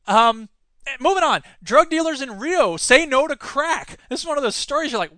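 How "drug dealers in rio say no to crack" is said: ordinary delivery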